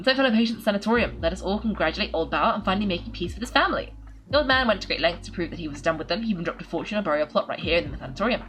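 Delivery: chatty reading of a note to fellow patients; letter read aloud. Acted. Streamer reads the second sheet aloud, wry and chatty. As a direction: give the letter-writer's wry, joking tone.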